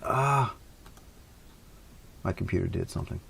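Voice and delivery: normal talking voice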